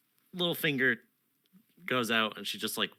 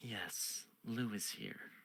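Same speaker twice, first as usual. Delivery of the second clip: soft queen; 2 semitones lower, 7 dB quieter than usual. Acted. Queen murmurs to the knight. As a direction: lean in and hush it.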